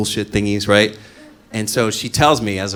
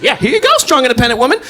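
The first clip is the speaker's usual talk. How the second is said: much higher and louder sneer; mocking sarcasm; 10 dB louder, faster than usual. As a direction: hand it over with a sneer. Sarcastic mockery of girlfriend.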